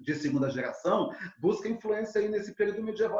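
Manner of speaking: ordinary talk, nothing marked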